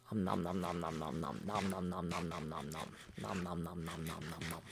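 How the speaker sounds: munching sounds, quiet and low; messy loud eating. Pretend. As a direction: fake chewing noises, nom nom. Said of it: "Sorry, I'm a little messy eater".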